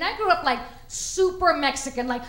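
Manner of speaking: ordinary talk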